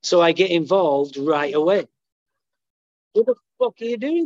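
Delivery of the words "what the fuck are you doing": quick, higher mock confrontation; indignant outburst. Speaker replays challenging the girl texting on stage, indignant. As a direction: fire it off fast like catching her out.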